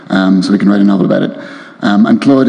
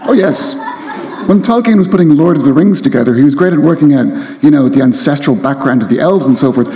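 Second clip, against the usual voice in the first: lively reading voice, a little higher; reading aloud; 3 semitones higher than usual. A narrator's reading. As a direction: read the novel with relish to the crowd. Author reads novel excerpt aloud.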